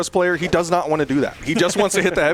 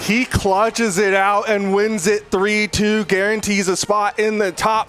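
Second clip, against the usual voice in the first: esports caster; 6 semitones higher, slower than usual. Real, not acted. Play-by-play winning call.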